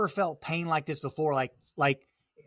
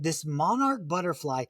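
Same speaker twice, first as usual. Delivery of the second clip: ordinary talk, nothing marked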